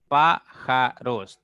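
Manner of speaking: loud, slow "Pájaros" with a gap after each syllable; "Pájaros" in three slow, projected beats. Shown as a demonstration. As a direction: break "Pájaros" into Pá, ja, ros. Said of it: "Tiene tres sílabas".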